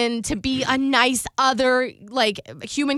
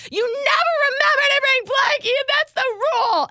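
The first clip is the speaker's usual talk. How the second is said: high-pitched mock tantrum; retold kid's yell, much higher; imitated child yelling; 11 semitones higher than usual. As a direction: whine it high like an accusing kid. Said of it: "are you yelling at your mo".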